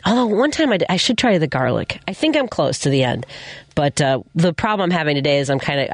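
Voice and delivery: normal talking voice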